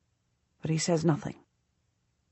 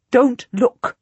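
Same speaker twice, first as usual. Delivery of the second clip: raised, higher, insistent plea; Don't look said louder and pleading; louder pleading repeat; 5 semitones higher, 6 dB louder than usual. Acted. Duchess pleading with the king, louder urgent repeat. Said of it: "I repeat".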